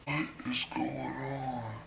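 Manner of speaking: slow, croaky whispered groan; just-woken mom. Retold or imitated. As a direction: drag it out, barely awake. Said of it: "she had just woken up".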